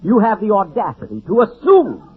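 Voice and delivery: hammed-up indignation at the thrift; indignant scolding. Jack berates Don for saving him money, mock outraged.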